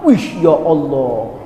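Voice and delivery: shocked gasp. Acted. Prophet shocked seeing hell.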